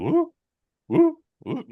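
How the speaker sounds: high-pitched woo, repeated; short high hoots in imitation; mock "woo". Mimicking a man idly scrolling, playful high woos. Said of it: "my man was literally going".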